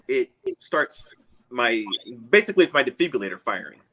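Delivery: usual speaking voice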